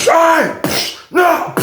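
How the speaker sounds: high yelps of surrender; imitated panicked yelling. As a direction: act out a man giving up as guards pile on. Acts man being rushed by guards.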